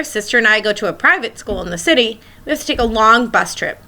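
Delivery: ordinary talk, nothing marked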